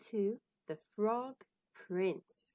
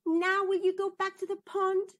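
pushy princess; 9 semitones higher, 6 dB louder than usual. Acted. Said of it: "said the princess".

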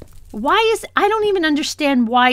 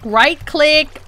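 ordinary talk, nothing marked

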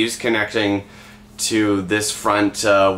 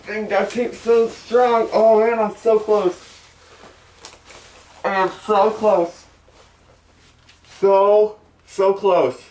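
straining; 9 semitones higher, slower than usual. Real, not acted. Struggling into a tight shirt.

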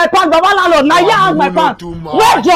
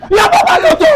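angry shout; 10 semitones higher than usual. Acted. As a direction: scream the insult at them. Hurling insult in compound quarrel.

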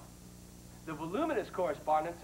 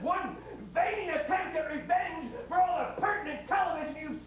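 furious rant; 8 semitones higher than usual. Real, not acted. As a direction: rage at the sponsors near tears. Host ranting at sponsors.

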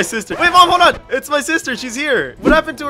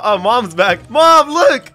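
ordinary delivery

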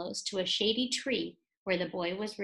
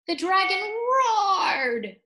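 dramatic roar; 9 semitones higher, 7 dB louder, much slower than usual. A narrator's reading. Climax, dragon roars.

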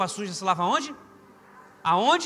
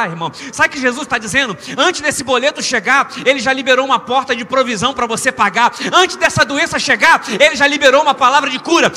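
full-force preaching; 7 semitones higher, 15 dB louder than usual. Real, not acted. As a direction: shout the promise out to the congregation. (pregação pentecostal a plenos pulmões)

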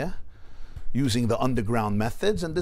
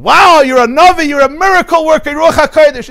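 amazed exclamation; 17 dB louder than usual. Retold or imitated. Informer amazed at the Rebbe.

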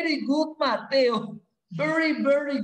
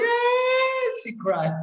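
mock wail; 12 semitones higher, much slower than usual. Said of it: "Pamela se pone".

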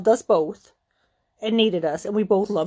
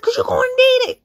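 high, loud screech; reenacted screaming threat; 5 dB louder, faster than usual. Retold threat in fight.

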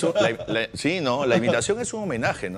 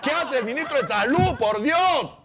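high exasperated ranting; outraged rant; 8 semitones higher than usual. Acted. Impression ranting at ministers, high exasperated outrage. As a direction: pitch the outrage way up. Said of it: "tu imitación".

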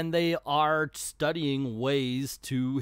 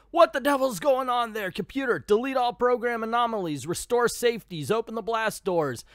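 urgent officer; 8 semitones higher than usual. Acted. Instructor orders the computer.

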